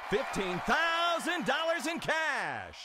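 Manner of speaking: excited. Acted. Host announces big cash prize.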